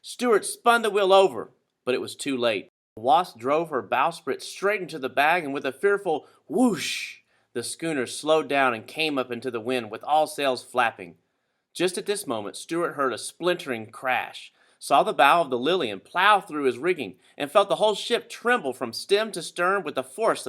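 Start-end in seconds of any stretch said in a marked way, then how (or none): none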